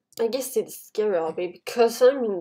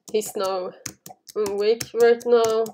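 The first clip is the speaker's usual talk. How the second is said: ordinary delivery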